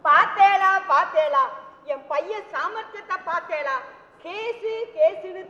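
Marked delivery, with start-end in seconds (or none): 0.1-1.4 loud, excited call to her husband. Acted. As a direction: burst out, delighted. Mother excitedly calls husband. (உற்சாகமான உரத்த அழைப்பு)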